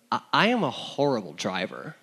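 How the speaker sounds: ordinary delivery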